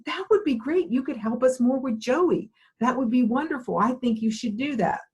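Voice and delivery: mock-eager husband gushing yes; husband's eager reply. Wife retelling husband's surprising enthusiastic yes, pitched high.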